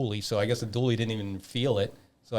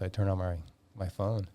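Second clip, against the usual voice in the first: ordinary talk, nothing marked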